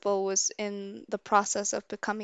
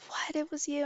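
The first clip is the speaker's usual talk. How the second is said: higher, incredulous retort; imitating shocked friend; 6 semitones higher, 4 dB quieter than usual. Friend learning who did it, imitated astonishment. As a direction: play the friend's disbelief, pitched up.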